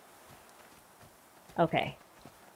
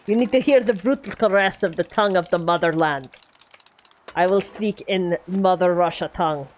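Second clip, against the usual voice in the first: put-on Russian accent with articles dropped. Gamer obeying Soggy's request, in a mock Russian accent. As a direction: play it as a hammy Russian accent. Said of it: "Why you make me do Russian accent?".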